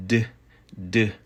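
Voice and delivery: "d" as an isolated d sound. Shown as a demonstration. Teacher shows how Y may sound, a lone "d". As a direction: sound out "d" crisply on its own. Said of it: "as a D sound".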